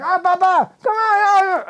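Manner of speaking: high, loud call to hurry him. Retold or imitated. Devotees calling the old man onto the moving truck, high loud call. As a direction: shout him on to catch the truck.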